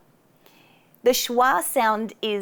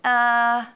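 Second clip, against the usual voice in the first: lazy relaxed uh sound; thinking uh; 2 semitones higher, 4 dB louder than usual. Shown as a demonstration. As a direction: let a lazy uh drop out. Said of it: "so it's just really lazy".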